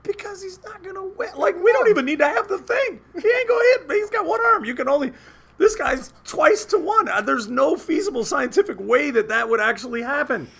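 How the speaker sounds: very high, squeaky-pitched protest; hammed-up high-pitched exasperation; exasperated argument. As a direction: argue incredulously way up in pitch.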